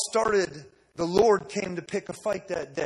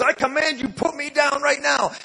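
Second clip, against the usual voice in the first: high, loud command; commanding shout; 7 semitones higher, 7 dB louder than usual. Retold or imitated. George orders the kid to put him down, loud command. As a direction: order it with full force. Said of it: "George goes".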